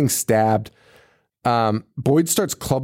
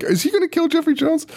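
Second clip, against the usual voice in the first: shocked question; 13 semitones higher than usual. Retold or imitated. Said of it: "And I'm like".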